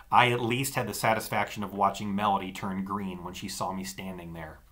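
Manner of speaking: ordinary delivery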